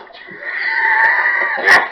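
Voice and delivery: very high, loud squeal of laughter; piercing cackle; loud shrieking laugh. Real, not acted. Laughing at own story.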